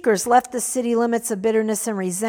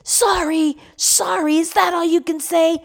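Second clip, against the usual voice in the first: enraged spew; 7 semitones higher than usual. Acted. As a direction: spit the apology back at him. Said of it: "the enraged young man spewed".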